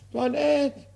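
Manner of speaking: a high, strained cry for the maid; desperate call. Acted. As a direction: shriek her name in despair. Argan calling his maid.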